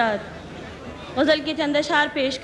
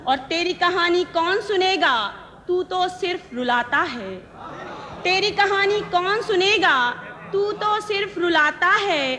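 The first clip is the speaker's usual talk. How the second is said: measured verse, repeats lifted higher; ghazal recitation; 3 semitones higher than usual. Acted. Poet presenting her ghazal to the gathering, measured recitation. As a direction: recite each line deliberately, pausing between phrases.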